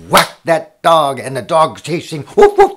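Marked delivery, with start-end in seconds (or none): 2.2-2.8 high, loud woofs; dog barking. Acted. Storyteller voices a dog chasing the cat, loud high barks. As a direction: bark like an excited dog.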